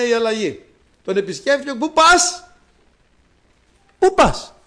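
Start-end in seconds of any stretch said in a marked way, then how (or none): 1.7-4.5 high, loud calling voice; a divine voice calling out to stop him; Jesus calls out loudly and high to the man on the plane. Acted.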